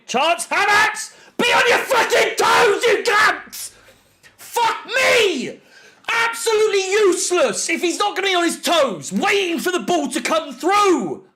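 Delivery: real high-pitched, exasperated yelling; furious shout. Real, not acted. Fan raging at player who missed a chance, furious shouting. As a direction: scream in outrage at a wasted chance.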